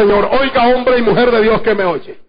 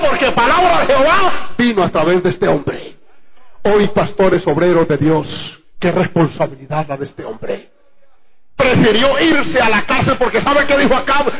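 normal talking voice

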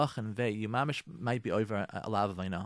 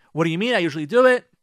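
defensive wife; 4 semitones higher, 10 dB louder, faster than usual. Pretend. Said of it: "wife defends herself".

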